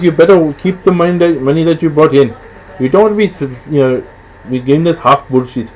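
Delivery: put-on high, animated Indian mother accent. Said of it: "I can get away with doing the accent".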